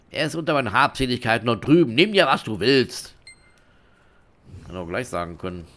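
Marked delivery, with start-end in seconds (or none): 0.1-3.1 loud, mildly hammed-up surrender; surrendering dealer. Beaten dealer pointing to his belongings, loud acted surrender. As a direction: hand it all over, stretching the last words.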